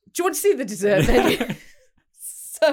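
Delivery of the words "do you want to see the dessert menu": clueless waiter. Retold or imitated. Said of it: "repeats waiter line".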